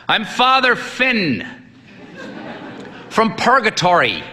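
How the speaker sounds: put-on high, slow, booming old priest; hearty old priest. A narrator's reading. Old priest introducing himself to the sick boy, hearty. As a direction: greet him like a jovial old priest. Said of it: "he said in a hearty voice".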